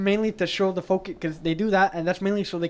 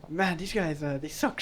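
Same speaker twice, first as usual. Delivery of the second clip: retold whisper; 2 semitones lower, 8 dB quieter than usual. Retelling a remark to a friend in the cinema, hushed. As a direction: drop the voice as if confiding in a friend. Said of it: "I whisper to my friend".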